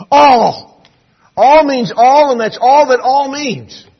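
every "All" punched high and very loud; stress on ALL. Shown as a demonstration. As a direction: preach it hard, leaning on each "all". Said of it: "It's emphatic in its emphasis".

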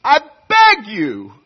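slow, loud, high pleading plea; the Lord imploring his people, slow and loud; God pleading. Acted. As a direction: beg as God would, drawn out. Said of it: "God says".

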